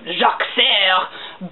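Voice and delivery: drawn-out mock French name; slow, put-on French pronunciation; bad French accent. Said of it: "bad French accent".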